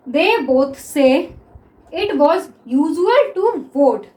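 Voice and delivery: each word drawn out slowly; slow dictation. Shown as a demonstration. Teacher dictates the next sentence to students, very slow. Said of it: "next देखिए".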